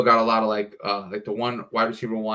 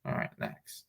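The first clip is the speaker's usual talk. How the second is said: whispered; 12 semitones lower, 14 dB quieter than usual. Real, not acted. Muttered off-mic aside.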